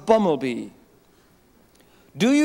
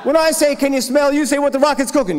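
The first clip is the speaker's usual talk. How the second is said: loud, high hype-man calls; crowd call-and-response shout; 6 semitones higher, 5 dB louder than usual. Real, not acted. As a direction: pump the room up, shouting the cue.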